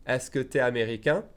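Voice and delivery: "tu es" reduced to "t'es", rising end; contracted question. Shown as a demonstration. Teacher repeats the fast form for learners, "t'es" contracted. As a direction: say "t'es" as one syllable. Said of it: "If I say it fast".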